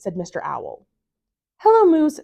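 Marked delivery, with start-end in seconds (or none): 1.6-2.2 high, loud sing-song chorus greeting; children chanting hello together; class in unison. A narrator's reading. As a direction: greet like a whole class at once. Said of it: "said the class in unison".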